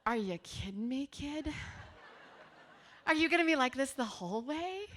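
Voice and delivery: laughing, disbelieving parent; half-laughing are you serious; mock exasperation. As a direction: parent teasing the weepy daughter.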